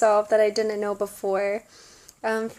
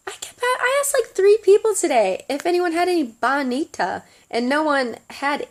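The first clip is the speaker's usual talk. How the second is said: much higher, louder exasperated rush; 6 semitones higher, 5 dB louder than usual. Retold or imitated. Telling her friend Maria about the failed search: exasperated. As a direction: pitch up, vent the frustration.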